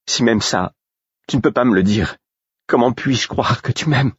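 high-pitched angry reproach; indignant outburst; furious. A narrator's reading. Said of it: "siffla-t-elle furieuse".

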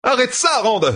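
very high, urgent cry; alarmed protest. A narrator's reading. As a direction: cry out for it to stop. Matt lifted into the air.

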